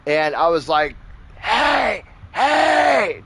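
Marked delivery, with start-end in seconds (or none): 1.4-3.2 high-pitched, drawn-out hollered hey; calling for attention. Retold or imitated. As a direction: holler to someone across the yard. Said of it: "trying to get his attention".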